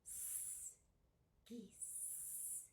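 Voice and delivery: S hissed, then Geese whispered; isolated s sound. Shown as a demonstration. Teacher models the ending S for children, whispered. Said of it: "That's right".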